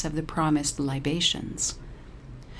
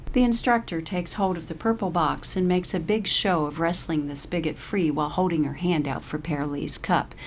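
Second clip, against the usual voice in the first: usual speaking voice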